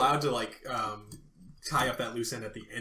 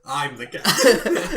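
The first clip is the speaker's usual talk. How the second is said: laughing mock captain; 5 semitones higher, 12 dB louder, much slower than usual. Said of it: "Connery quote laughing".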